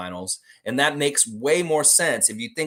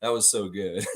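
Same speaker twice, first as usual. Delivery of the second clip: ordinary talk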